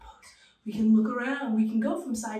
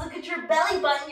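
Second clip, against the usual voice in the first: ordinary delivery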